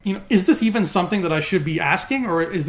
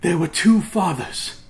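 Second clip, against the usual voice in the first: intense villain quote. Acted. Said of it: "say it with the same intensity that he did".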